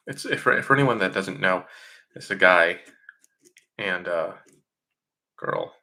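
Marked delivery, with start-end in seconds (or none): none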